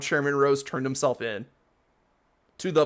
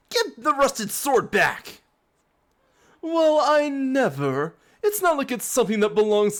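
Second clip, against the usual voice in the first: pompous outrage; 10 semitones higher, 4 dB louder than usual. Acted. Villain brothers scold the heroes, high affronted voice.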